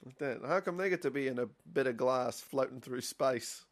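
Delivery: loud, petulant grumbling; loud whiny complaint. Pretend. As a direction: whine it like a hard-done-by citizen. Mock complaint about prism.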